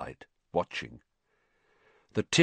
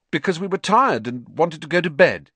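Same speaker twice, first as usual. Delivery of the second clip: higher, louder blurted excuse; anxious, raised-pitch protest; nervous excuse; 9 semitones higher, 6 dB louder than usual. A narrator's reading. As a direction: blurt out an unconvincing explanation.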